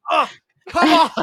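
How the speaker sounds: high, loud exasperated cry; frustrated yell. Retold or imitated. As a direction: shout at the screen in disbelief. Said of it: "I'm just like".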